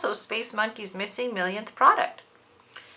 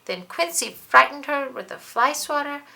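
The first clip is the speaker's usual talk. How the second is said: louder, dramatic recap of her bad luck; building dismay; 4 semitones higher, 8 dB louder than usual. A narrator's reading. Narrator lists the bug's troubles with growing drama. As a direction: pile up the misfortunes with rising alarm. Climax of misfortunes.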